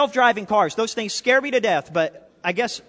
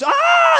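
sudden high scream of plane passengers, far above his usual pitch; panicked passenger scream; 11 dB louder than usual. Retold or imitated. Preacher telling the blind-pilot joke, as terrified passengers screaming. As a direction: let out a startled group shriek. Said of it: "everybody just goes".

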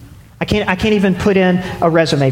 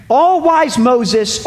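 ordinary delivery

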